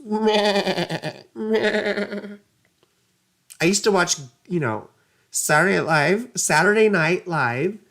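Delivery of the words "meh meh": lightly voiced bleating meh; goat bleat. Mimicking goats, a light bleat. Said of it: "My goats".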